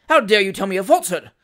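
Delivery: loud, quick indignation; affronted magistrate's outburst; outraged rebuke. Acted. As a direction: scold him for lying. Irate magistrate rebukes accused man.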